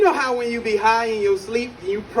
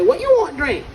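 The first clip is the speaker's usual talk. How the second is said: lightly mocked Chinese accent, pitched up; 8 semitones higher than usual. Server asks a regular for his order, mock Chinese accent. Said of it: "I'm so good at that accent".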